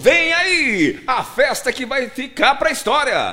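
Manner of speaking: high, loud hyped radio promo; party ad announcer. Shown as a demonstration. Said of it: "você vai falar de uma festa. É um tom".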